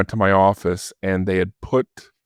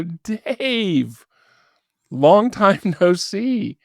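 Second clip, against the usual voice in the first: slow, much higher sing-song tease with a laugh; teasing greeting; 12 semitones higher, much slower than usual. Retold or imitated. Caller taunting Dave on the phone, slow high-pitched teasing. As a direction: stretch it out like you caught him red-handed.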